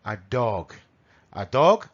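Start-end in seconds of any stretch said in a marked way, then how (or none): 1.3-1.9 dog with a long rounded o and final g; DOG with long vowel. Shown as a demonstration. Teacher contrasting dog with duck, drawn-out vowel. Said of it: "a ver si oís la diferencia".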